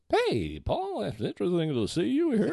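high, put-on casual greeting; mock-nonchalant high voice; fake casual. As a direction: act cool while clearly starstruck. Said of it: "And I went".

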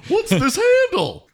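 high puzzled silly voice; clueless driver. Retold or imitated. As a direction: squeak out the confusion. Mocking Oregon drivers.

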